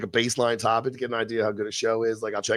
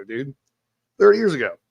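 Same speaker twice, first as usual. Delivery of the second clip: ordinary talk, nothing marked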